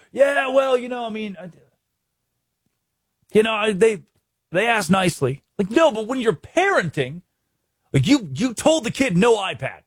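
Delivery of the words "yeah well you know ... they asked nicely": mocking lax parent. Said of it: "you're like".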